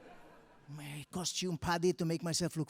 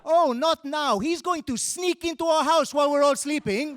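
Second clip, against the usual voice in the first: mock panic; 10 semitones higher, 9 dB louder than usual. Parent's panicked aside, retold.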